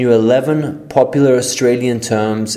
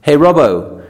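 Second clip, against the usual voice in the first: louder, higher, quick mates' chat; loud Aussie banter; 4 semitones higher, 4 dB louder than usual. Acted. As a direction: call across to your mate, fast. Acted pub-plan dialogue.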